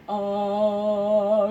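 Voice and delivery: low, loud held note like a big papa; long low papa-voice sound; papa's deep voice. Shown as a demonstration. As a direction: drop into a big low daddy voice, drawn out. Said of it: "ta grosse voix grave de papa".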